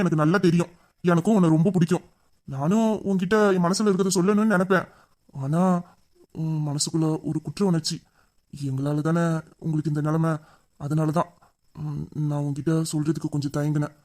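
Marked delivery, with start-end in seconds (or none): 5.4-14.0 soft confession. Acted. Hero confesses love softly.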